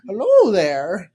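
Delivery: a doddering old toff playing innocent; transatlantic old. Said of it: "transatlantic old".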